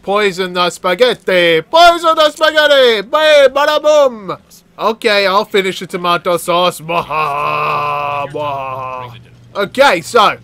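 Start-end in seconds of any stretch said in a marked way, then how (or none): 1.6-4.4 high, loud, theatrical exclamation; playful crowing. Pretend. Player poisoning the spaghetti, playful crowing. Gleeful repeat while poisoning food. 6.8-9.2 slow, drawn-out fake villain cackle; mock evil laugh. Poisoner over the tomato sauce, mock evil laugh.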